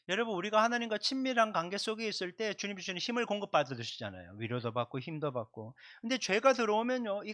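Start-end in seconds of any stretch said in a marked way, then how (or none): none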